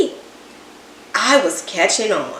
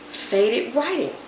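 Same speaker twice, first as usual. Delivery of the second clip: plain reading voice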